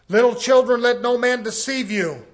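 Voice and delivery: loud, ringing call to the congregation; booming scripture reading. Acted. Preacher warning his flock, loud and ringing. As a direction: proclaim the verse like a warning. Preacher reads verse aloud.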